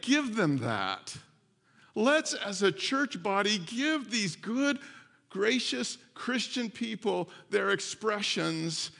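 slow, weighty, every word leaned on; slow emphatic appeal. Acted. Pastor urging church to forgive, slow emphatic appeal. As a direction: drag it out to drive it home. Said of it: "slow emphatic appeal".

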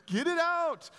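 high, loud cry of "Get it out"; preacher's loud mock prayer. Acted prayer against Satan.

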